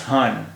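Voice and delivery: हान ending on a sounded n; full final n. Shown as a demonstration. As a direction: say हान with the tongue closing on a clear n. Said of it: "तो इसका उच्चारण होता".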